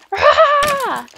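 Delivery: loud, high mock war cry; battle cry. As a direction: charge in yelling. Charging a skeleton.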